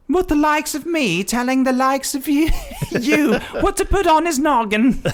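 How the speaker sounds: high put-on Glasgow maid voice; broad Scottish accent. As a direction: sass it in a thick Scottish accent. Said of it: "lonely whatever maid from Glasgow".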